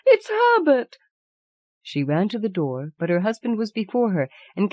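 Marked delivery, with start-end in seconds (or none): 0.1-1.0 even higher wild scream. Acted. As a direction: shriek it, beside herself. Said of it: "she screamed".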